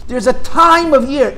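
slow phrase leaning hard on "time"; stress on "time". Real, not acted. Teacher marking the special season for students, stress on "time". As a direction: draw out "time" as the key word. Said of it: "emphasis on the word time".

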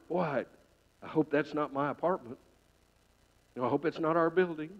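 mother fearing for her apartment, quietly; worried quiet thought. Acted. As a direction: murmur the worry under breath.

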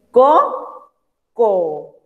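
coco drawn out, ko and ko apart; slow syllable demo. Shown as a demonstration. Teacher to pupils, breaking coco into syllables slowly. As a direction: split coco into syllables for the class. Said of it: "Tiene una consonante y una".

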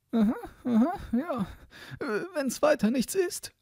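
high, put-on sexton voice; high-voiced, hemming character; self-assured sexton.

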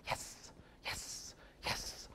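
whispered, hissing "Yes" repeated identically; soft voiceless hiss on each "Yes"; hissed marker. Shown as a demonstration. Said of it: "if I've gone".

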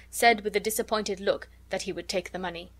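ordinary delivery

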